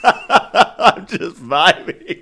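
high giggly drawn-out vibing; laughing. Pretend. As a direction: laugh through the words, slowly. Joking about scent vibes.

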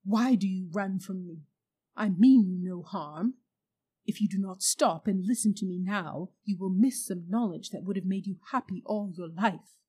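loud, coaxing witch voice; witch pleading. A narrator's reading. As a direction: lure the boy back. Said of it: "cried the witch".

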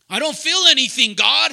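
mock complaint that prayer feels empty; frustrated complaint. Acting frustrated praying husband.

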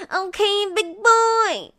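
high, loud teasing farewell; flirty high sign-off. Acted. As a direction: tease him goodbye, high and slow.

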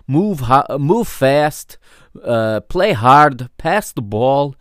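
put-on 2 slow, deliberate Portuguese-accented coach's orders; 3 bark simple instructions like an old Portuguese coach, in a Portuguese accent. 4 imitating the Portuguese coach instructing his team, Portuguese accent. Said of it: "that Portuguese accent".

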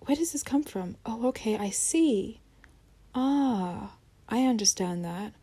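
quietly understand yourself; a hint of soft self-talk; gentle inner voice. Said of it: "this is preventing you".

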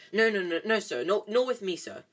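anxious, emphatic backtracking; flustered placating. A narrator's reading. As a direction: rush to reassure an offended customer. Shopkeeper hastily placating rich customer.

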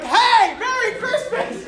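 high, bright mock cheer; cheery greeting. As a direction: call out a goofy holiday greeting. German runs over with a box.

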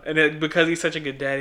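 usual speaking voice

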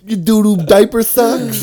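put-on high, drawn-out childish taunt; mock roast. Friend mocking John's baby socks, high slow jeer. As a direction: tease slowly in a silly high voice. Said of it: "Us roasting John's fucking baby socks".

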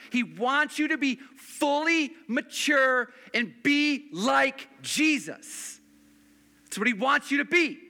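rising pulpit intensity; full-force preaching. Acted. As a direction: build it up like a revival preacher. Pastor builds to a climax.

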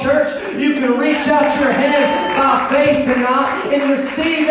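rally the church with mounting fervor; climactic preaching. Real, not acted.

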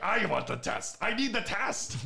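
retold angry demand, pitch climbing high; mock angry. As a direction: act out comic outrage, rising on the second demand. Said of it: "making me very angry".

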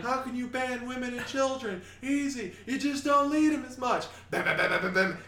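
very high, loud, manic gunner; crazed door gunner. Acted. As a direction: play a gleeful crazed gunner. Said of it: "Full Metal Jacket reference".

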